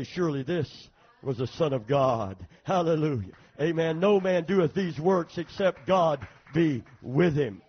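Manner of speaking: loud, booming declaration from the pulpit; ringing sermon climax; preaching at full force. Real, not acted. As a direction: proclaim it loudly with weighty emphasis.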